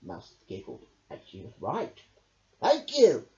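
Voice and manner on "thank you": high, loud squeal of thanks. Acted. Hedgehog thanks friends for the shed, squealing high. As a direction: squeal with delight. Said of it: "squealed Hedgehog".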